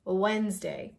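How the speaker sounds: Wednesday as "wenz-day"; Wednesday in two syllables; silent D. Shown as a demonstration. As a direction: say Wednesday without the D. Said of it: "Forget about the D".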